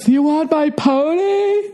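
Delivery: very high, slow put-on voice of a tipsy guy; drunk tuxedo guy. Drunk guy in a tuxedo offers his pony, in a high slow voice. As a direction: offer the pony in a sugary falsetto.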